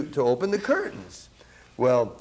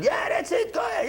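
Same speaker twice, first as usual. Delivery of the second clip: egging on, shouting. Retold or imitated. Drummer egging the sax player on, shouting. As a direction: yell it like a drummer cheering you on. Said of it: "screaming at me".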